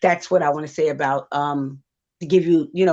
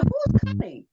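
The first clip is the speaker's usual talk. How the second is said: high-pitched retold question; high, lightly acted child's query; puzzled child. Story: asks who is coming.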